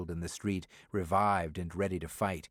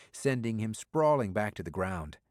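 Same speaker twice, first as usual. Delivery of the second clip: ordinary delivery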